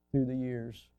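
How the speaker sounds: usual speaking voice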